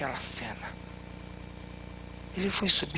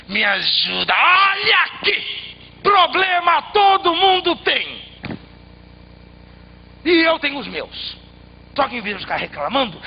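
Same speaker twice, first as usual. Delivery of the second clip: irritated man; 9 dB louder than usual. A narrator's reading. Annoyed traveller scolds the begging tree, loud shout. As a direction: snap at the tree, then lecture it.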